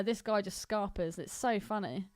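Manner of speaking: normal talking voice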